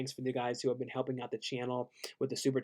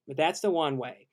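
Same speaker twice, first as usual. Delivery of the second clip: ordinary talk, nothing marked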